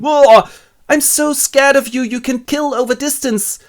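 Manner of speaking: high, loud, frightened awe; awed fear. Acted. Friday awed by Robinson's gun, high and scared. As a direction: cower before the gun.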